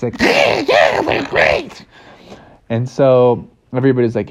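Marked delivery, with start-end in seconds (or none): 0.2-1.8 very high, squawky duck voice; high, garbled cartoon duck; Donald Duck. Donald Duck replies again.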